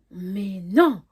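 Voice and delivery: loud, emphatic denial; loud disbelief. Retold or imitated. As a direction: hit "non" hard, refusing to believe it. Incrédule, seconde réaction plus forte. (incrédulité, « non » lancé fort)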